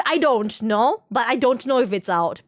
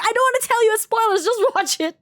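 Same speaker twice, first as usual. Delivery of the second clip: much higher, eager insisting; playful retold insistence; high insistent quote; 11 semitones higher than usual. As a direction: push the friend to just watch it. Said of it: "I'm like".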